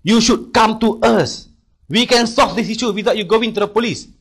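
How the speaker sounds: imitating the boss's loud, slow telling-off; angry scolding. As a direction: berate him down the phone, drawing it out. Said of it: "Dia maki hamun saya dalam bahasa Inggeris".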